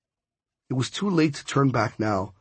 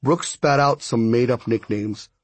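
ordinary delivery

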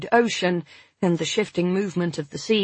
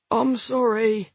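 croaky with pain; 5 semitones higher, slower than usual. Acted. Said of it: "his voice still croaky with suppressed pain".

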